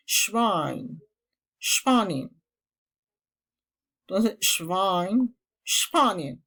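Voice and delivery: Schwein said shvine, starting with sh; German pronunciation. Shown as a demonstration. Teacher repeating Schwein with the sh sound. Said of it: "la S impura".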